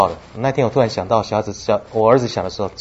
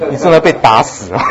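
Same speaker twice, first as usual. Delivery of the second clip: ordinary talk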